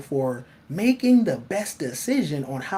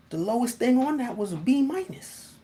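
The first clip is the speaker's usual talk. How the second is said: ordinary delivery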